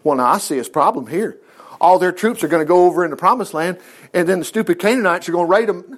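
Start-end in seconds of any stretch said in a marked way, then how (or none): none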